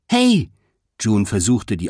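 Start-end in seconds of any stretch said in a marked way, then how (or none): 0.1-0.5 high, loud attention-getting call. A narrator's reading. June trying to get the mascots' attention, calling out. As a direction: call after someone rushing past.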